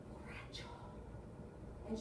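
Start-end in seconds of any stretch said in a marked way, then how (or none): 0.1-0.7 eerie unvoiced whisper; old lady. Old lady pointing at the drunk, whispering. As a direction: whisper it as a quiet accusation. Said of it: "it's like an old lady's pointing at him".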